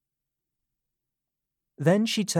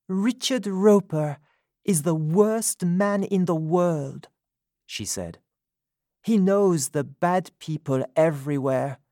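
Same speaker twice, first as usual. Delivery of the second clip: Sophie's voice; 6 semitones higher than usual. A narrator's reading. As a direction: speak up as her, indignant. Sophie describing Roper.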